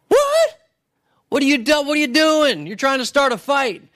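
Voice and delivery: high-pitched incredulous "What?!"; mock outrage. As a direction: play a king hammily taken aback, voice jumping up. King reacts in outrage to letter.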